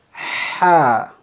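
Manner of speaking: Ha, air pushed up from the throat; Arabic ha. Shown as a demonstration. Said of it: "Remember, we pronounce from the truth".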